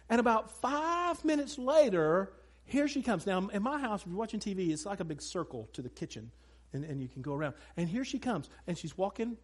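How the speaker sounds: normal talking voice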